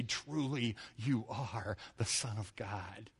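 hushed worship. Retold or imitated. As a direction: whisper it in reverence. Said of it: "you say".

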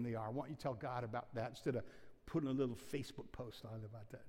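soft, low, sinking inner rebuke; the Spirit chiding you gently under your breath; quiet voice of the Spirit. Retold or imitated. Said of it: "and the Spirit says".

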